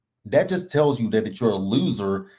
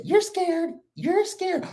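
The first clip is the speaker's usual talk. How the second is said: much higher taunting voice; high mock Whitney; slower than usual. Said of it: "And so Whitney said".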